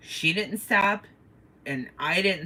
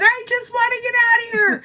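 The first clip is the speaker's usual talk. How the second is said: desperate plea; 6 dB louder than usual. Retold or imitated. Pleading to escape crowd.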